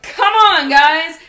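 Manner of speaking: high, loud cheerful call; high, loud call to the geese. Retold or imitated. Calling the geese in.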